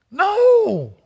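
preacher's emphatic rebuttal. Real, not acted. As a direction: slam the answer down. Preacher answers own question.

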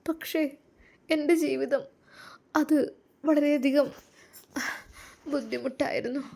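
high, soft voice on the edge of tears; a young wife near tears over her hard past; tearful. Acted. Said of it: "നീ ഇങ്ങനെ കരയല്ല ട്ടോ".

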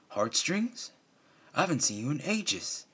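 high, surprised shopkeeper voice; baker's greeting. Acted. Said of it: "Pony Joe replied".

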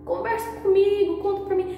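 high, coaxing mock plea; nosy friend. Mimicking a nosy friend who wants gossip, in a high coaxing voice. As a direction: mimic a prying friend begging you to open up. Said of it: "a pessoa chega pra mim e fala".

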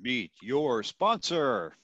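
slow, drawn-out monster truck announcer. Acted. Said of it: "Kim has the best monster truck voice".

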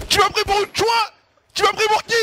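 loud high indignant yell; outraged shout. Real, not acted. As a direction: yell it out, offended.

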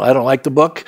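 ordinary delivery

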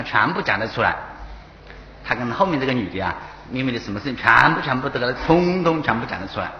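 usual speaking voice

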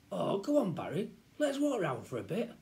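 put-on high, pleading kid; coaxing boy. Child begs Barry to walk round, coaxing. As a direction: wheedle a pal into agreeing. Boy coaxes his friend.

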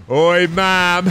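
loud hammed-up cockney accent, Mom; mock cockney. Streamer playing a rowdy crew to his mom, loud mock cockney accent. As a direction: shout it like a rowdy London geezer in a put-on cockney. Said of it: "Oh, fucking British, innit?".

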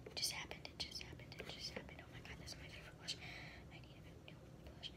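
repeated whispers in dismay; whisper, frantic and crushed; quick whispered panic; panicked whisper. Real, not acted. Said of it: "I'm having a panic attack".

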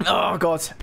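ordinary talk

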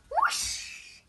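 high breathy whoosh sound. A narrator's reading. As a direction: blow a rushing whoosh. Sound effect.

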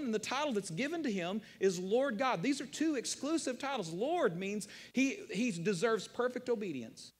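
usual speaking voice

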